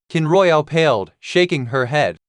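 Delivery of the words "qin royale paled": usual speaking voice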